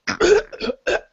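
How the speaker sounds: imitated strangled, sputtering cough; choking cough. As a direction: cough like your windpipe is being crushed. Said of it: "coughs and, and, um, struggles to breathe".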